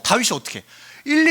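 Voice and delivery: usual speaking voice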